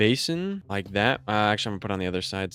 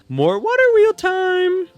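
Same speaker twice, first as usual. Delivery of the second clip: loud, very high, drawn-out squeal; high-pitched mock fanfare shout; playful announcement; 9 dB louder, slower than usual. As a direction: announce it in a silly high holler, stretching the end. Playful announcement of next task.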